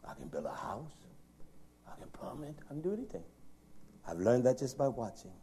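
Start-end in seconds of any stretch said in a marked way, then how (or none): 0.1-3.2 hushed unvoiced whisper. Real, not acted. Preacher hushing the congregation, whispered. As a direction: breathe it, barely voiced. Boasting of self-taught skills.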